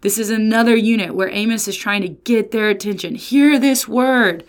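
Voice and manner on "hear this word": slow proclamation. Shown as a demonstration. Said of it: "this literary marker".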